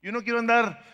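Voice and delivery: loud, high, driving sermon voice; fiery preaching. Acted. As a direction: hammer it home to the congregation. Preaching at full force. (predicación enérgica y a gritos)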